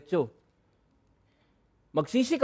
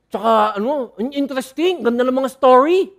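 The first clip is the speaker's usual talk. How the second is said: mock gushing listener; 4 semitones higher, 6 dB louder than usual. Mocking churchgoer's shallow praise.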